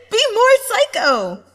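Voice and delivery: loud high cheering cry; fangirl cheer. Acted. A fangirl cheers the psycho on, loud cheer. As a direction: yell it like a fangirl.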